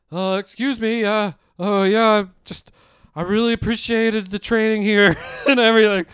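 high, loud, halting nervous excuses; acting out a jittery employee quitting; anxious quitting speech. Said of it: "I was all anxiety".

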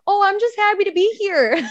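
high, sunny and content, a mock-happy Minnesotan; cheery Minnesotan. Minnesotan fan mocking her own state's mild contentment, high and sunny. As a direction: chirp it like you're just glad to be anywhere. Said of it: "we're like".

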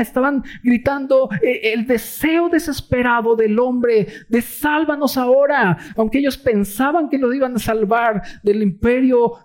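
high, impassioned sermon voice; fervent preaching. Acted. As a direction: preach it with fire and urgency. Preaching at full force. (predicación ferviente y aguda)

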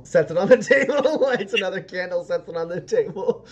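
laughing while describing candles. Real, not acted. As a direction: giggle through the words.

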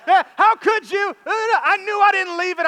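put-on high, loud, slow indignant child; imitating angry daughter. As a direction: drag it out like an outraged kid. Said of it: "now she's mad at me".

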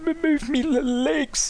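put-on high, strained old man; squeaky frail old man. Old man voiced in a story.

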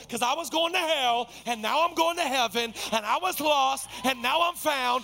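high, loud building testimony; fervent preaching. Real, not acted. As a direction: preach it to a peak.

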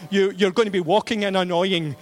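usual speaking voice

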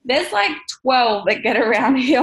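amused laugh breaking in. Real, not acted. Telling viewers about all the Jeeps, laughing.

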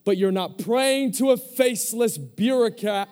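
loud, high preacher's insistence; emphatic preaching. Acted. Preacher repeating whom they are not praying to, emphatic. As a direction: hammer the point home to the congregation.

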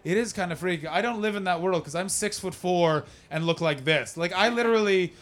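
ordinary delivery

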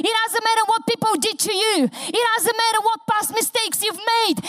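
high, fast, driving sermon voice; fervent preaching. Acted. Preacher rousing the congregation to rejoice, fervent and fast. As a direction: preach it hard and fast to the crowd.

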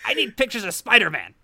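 lightly voiced gruff newspaper editor; J. Jonah Jameson impression. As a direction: bark it like a pushy editor. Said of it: "ask me for pictures of".

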